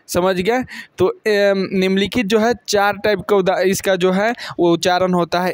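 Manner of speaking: ordinary talk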